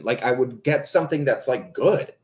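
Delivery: ordinary delivery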